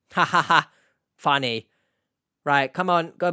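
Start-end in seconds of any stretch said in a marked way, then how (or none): 0.1-1.7 louder, forced ha-ha-ha, then a dry word; fake sarcastic laugh. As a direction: laugh mockingly as if at a bad joke. Sarcastic laugh at own dark joke.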